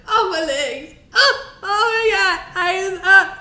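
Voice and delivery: high-pitched wailing in agony; hammed-up sobs over leg cramps; imitating screaming, crying. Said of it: "He was screaming, crying".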